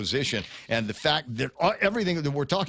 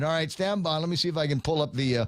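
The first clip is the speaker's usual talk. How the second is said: ordinary delivery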